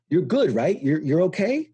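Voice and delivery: high, breezy are-you-ok; casual check-in. Retold or imitated. People checking on a veteran, lightly voiced high and breezy. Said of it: "it's like".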